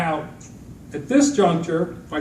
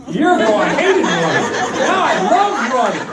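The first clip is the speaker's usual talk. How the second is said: laughing; 7 semitones higher, 8 dB louder than usual. Real, not acted. Joking about loving running.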